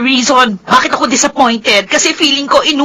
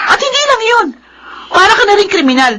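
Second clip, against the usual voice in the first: angry shout; 6 semitones higher than usual. Acted. Daughter accusing Sandro, shouting.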